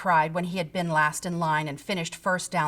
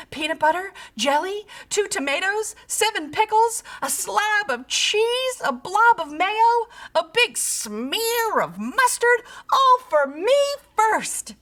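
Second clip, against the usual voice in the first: higher and louder with each filling; growing excitement; 11 semitones higher, 4 dB louder than usual. A narrator's reading. Pig dreaming of his sandwich, excited. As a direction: savour each bite in your head.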